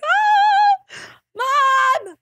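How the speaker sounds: high shrieking cry for mom; frightened child screaming Mom; retold scream. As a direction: shriek for your mother, scared. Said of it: "and I screamed".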